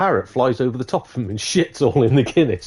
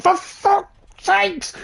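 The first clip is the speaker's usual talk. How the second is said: very high, drawn-out mock fury; exasperated outburst; much slower than usual. Customer covered in parrot mess, high exasperated outburst. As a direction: stretch it into a high strangled curse. Customer fouled a third time.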